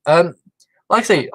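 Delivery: ordinary delivery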